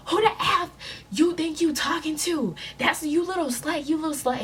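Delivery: put-on high, loud taunting girl voice; mocking angry girls. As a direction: sneer it like a rowdy girl picking a fight. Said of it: "her friends like".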